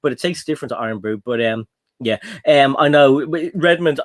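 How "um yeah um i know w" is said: ordinary talk, nothing marked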